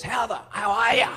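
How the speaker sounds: high, loud mock pub greeting; pub bloke's greeting. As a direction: holler it like a bloke leaning on the bar.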